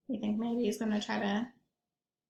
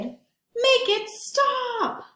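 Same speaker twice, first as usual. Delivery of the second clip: much higher, slow, stretched-out wail; desperate plea; 13 semitones higher, 5 dB louder, much slower than usual. Acted. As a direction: beg for it to end in distress. Rabbit overwhelmed by bad mood.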